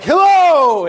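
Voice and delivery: a big, loud, high burst of joy; excited hello. Shown as a demonstration. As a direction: shout it like wonderful news. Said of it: "No, it's like".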